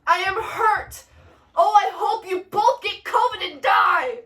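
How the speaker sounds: high, loud, hammed-up outrage; wounded Mercutio's curse. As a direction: cry out your injury and curse them both.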